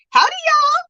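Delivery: high-pitched Southern accent imitation; Granny D. Streamer showing how a friend talks, high Southern accent. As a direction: pitch it way up, playful Southern twang. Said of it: "her little Southern accent".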